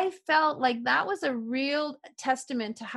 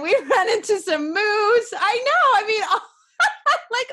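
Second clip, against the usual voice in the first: laughing excitedly; 10 semitones higher, 9 dB louder than usual. Real, not acted. Laughing about bears and moose.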